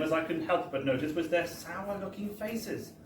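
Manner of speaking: ordinary delivery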